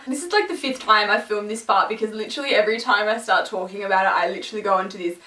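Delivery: usual speaking voice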